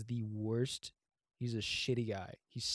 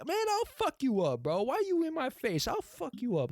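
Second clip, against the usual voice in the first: very high, loud mock threat; Bernice; 13 semitones higher, 4 dB louder than usual. No-nonsense tow crew woman threatens someone, hammed-up yelling. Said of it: "this no-nonsense woman who's just like".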